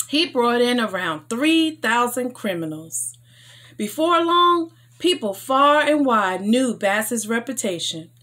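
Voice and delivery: ordinary delivery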